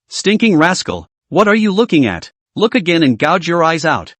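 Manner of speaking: usual speaking voice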